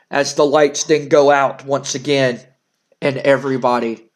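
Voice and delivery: normal talking voice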